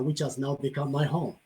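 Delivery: normal talking voice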